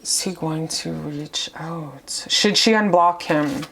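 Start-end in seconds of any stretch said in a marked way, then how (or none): 0.0-2.2 hushed question. Real, not acted. Quietly asking the cards.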